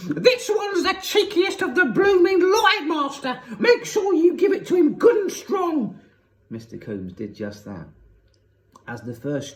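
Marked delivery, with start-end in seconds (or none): 0.1-6.0 dreadful high-pitched screech; Missus Pratchett. A narrator's reading. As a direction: screech spitefully, loud and high, to the headmaster. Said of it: "high-pitched voice behind me screeching".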